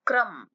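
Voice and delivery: क्रम with a quick half क, full र; half k pronunciation. Shown as a demonstration. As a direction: say क्रम, rush the क into र. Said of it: "क को जल्दी से बोलना है".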